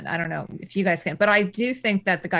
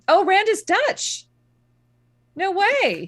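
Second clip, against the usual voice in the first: high-pitched, drawn-out disbelief; surprised; 13 semitones higher, 4 dB louder, much slower than usual. Real, not acted. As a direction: let the surprise leap up high. Learns an actor is Dutch.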